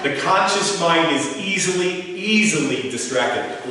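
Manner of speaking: easily drawn out slowly and stressed; emphatic slow. Shown as a demonstration. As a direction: lay the mantra down word by word, leaning on easily. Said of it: "key point repeated to audience".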